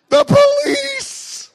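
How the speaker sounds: very high, very loud, slow, drawn-out cry; panicked wail. Retold or imitated. Boy confessing to his dad, high loud panicked wail. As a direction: wail it like a scared kid caught out. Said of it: "And I started to cry".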